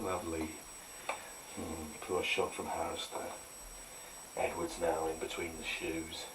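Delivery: snooker commentator. Pretend. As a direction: hush it like a snooker broadcast.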